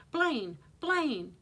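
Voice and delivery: high, loud cry of his name; screamed name. A narrator's reading. Aunt running to Blaine, distressed cry. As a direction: call out in distress, short of a full scream. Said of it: "Judith's voice, raw from screaming, choked with tears".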